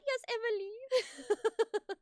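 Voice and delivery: lightly put-on French accent, slow and drawn out; a hint of French, stretched slow; playful mock French accent; small French accent. Shown as a demonstration. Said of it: "small French accent".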